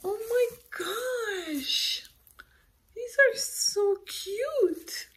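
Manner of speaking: very high, stretched-out gushing; real squealing delight. Real, not acted. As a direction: squeal slowly as if melting at cuteness. Delighted at more gnomes.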